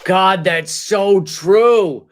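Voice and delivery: ordinary delivery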